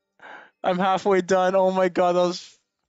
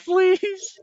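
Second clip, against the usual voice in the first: desperate pleading; 13 semitones higher than usual. Real, not acted. Begging the game for a lucky roll.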